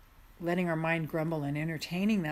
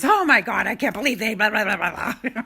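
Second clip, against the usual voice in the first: loud, higher mock outrage; mocking indignant mind; 3 semitones higher, 8 dB louder than usual. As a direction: play the grumbling mind, hammed up. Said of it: "even though our mind goes".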